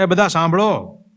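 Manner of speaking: a ghost begging the mourners to hear; pleading dead man. Acted. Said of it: "હવે એ પોતે એને બધાને કહેવા માંડે".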